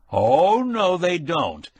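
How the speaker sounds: high, slow, loud refusal; fierce slow refusal. A narrator's reading. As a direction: shut it down fiercely. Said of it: "Quillerin said fiercely".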